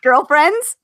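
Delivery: loud, high teasing question. Acted. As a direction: call it out gleefully.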